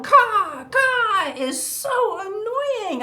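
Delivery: stretched, high mock crow caw; crow caw imitation. Imitating the crow's call.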